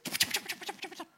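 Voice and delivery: high-pitched, rapid retold hustle; mock hurrying. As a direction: chivvy them out in a high rush. Retelling being hurried out.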